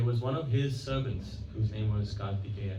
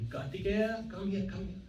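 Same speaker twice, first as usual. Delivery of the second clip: calling out; 8 semitones higher, 4 dB quieter than usual. Retold or imitated. Guru calls his servant from the room, calling out. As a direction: imitate calling a servant over in a raised pitch.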